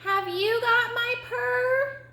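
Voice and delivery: high, drawn-out kitten call; very high, slow kitten question; kitten calling over. Acted. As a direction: call across as a little kitten. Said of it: "she called over the snuffling".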